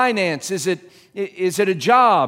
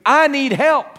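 higher, louder cry of I need help; raised, pleading confession; earnest plea; 7 semitones higher, 5 dB louder than usual. Pretend. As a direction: admit it with urgency. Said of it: "begin my prayer for rescue".

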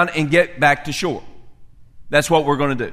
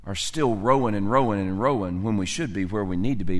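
normal talking voice

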